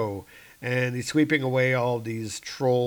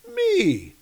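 incredulous squeak; 4 dB louder than usual. Acted. Thor incredulous.

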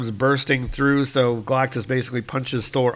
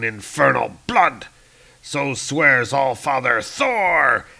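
loud, grand comic-book hero; defiant old Thor; 3 dB louder than usual. Acted. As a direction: declaim a thunderous oath of defiance. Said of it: "And Thor's going".

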